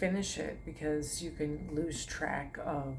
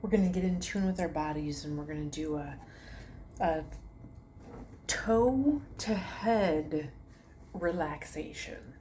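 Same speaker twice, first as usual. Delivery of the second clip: guided meditation. Acted. Yoga teacher to her viewers, guided meditation voice.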